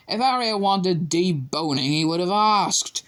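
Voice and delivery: mock the teacher dryly; sarcastic jibe. A narrator's reading. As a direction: Ron needling Hermione through curtain, sarcastic. Ron voiced sarcastically.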